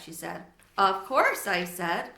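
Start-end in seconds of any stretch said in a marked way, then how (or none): 0.8-1.5 hamster voice. A narrator's reading. Hamster answers Sophie.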